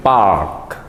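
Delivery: low park with lengthened A; long A in park. Shown as a demonstration. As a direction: hold the A in park. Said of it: "bacaannya agak panjang sedikit".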